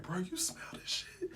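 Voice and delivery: very soft, unvoiced aside; conspiratorial whisper. Passenger discreetly asking the Indian dad, whispering. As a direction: whisper it secretly to a fellow passenger. Confides in the dad about smell.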